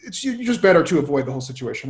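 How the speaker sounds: ordinary delivery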